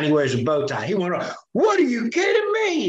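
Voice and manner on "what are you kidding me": high, shouted disbelief; incredulous judge. Retold or imitated. Judge hearing the NSA spied on spouses, high incredulous shout. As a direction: throw it out in shocked disbelief.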